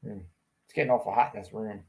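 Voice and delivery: ordinary talk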